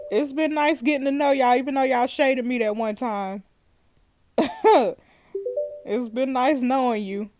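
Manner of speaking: normal talking voice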